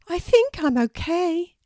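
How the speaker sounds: high, loud bird voice; recovering little raven. Acted. Poppy tells Harris how she feels, high raven voice. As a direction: answer in a small creature's high voice. Freed Poppy answers her friend.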